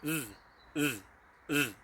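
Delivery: th buzzed with tongue behind top teeth; Welsh dd sound. Shown as a demonstration. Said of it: "you roll the tongue behind the top teeth".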